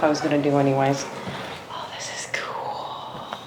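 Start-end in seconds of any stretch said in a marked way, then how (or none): 1.7-3.4 slow, very soft whisper of wonder; awed whisper. Real, not acted. Exploring another old building, slow hushed whisper. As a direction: barely breathe the words in awe.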